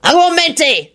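high-pitched spell call; shout. A narrator's reading. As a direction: shout the spell in the heat of a fight. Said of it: "Lupin shouted".

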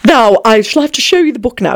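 plain reading voice

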